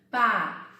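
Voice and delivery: PA alone, firm and loud, then stop; single syllable. Shown as a demonstration. Teacher starting to split a long word, single syllable. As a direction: sound out PA as one separate beat. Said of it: "então vamos pensar".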